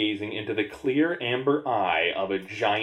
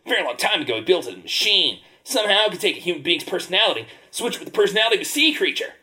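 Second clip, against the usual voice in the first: high, fast squid voice; lightly voiced sea creature; 8 semitones higher, faster than usual. Squid voice, speeding up.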